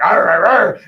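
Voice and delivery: loud creature-like noises, arr arr; character voice. Shown as a demonstration. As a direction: demonstrate a voice with big barked noises. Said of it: "one of the voices".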